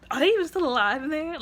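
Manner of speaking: loud, high-pitched disbelief; stunned viewer raising their voice; incredulous outburst. Retold or imitated. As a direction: exclaim it in astonishment. Incredulous reaction to crash.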